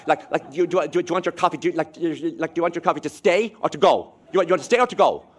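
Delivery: hint of a pushy coffee server; imitating Americans. Traveller mimics an American server asking about coffee, lightly mocking. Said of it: "these Americans talking".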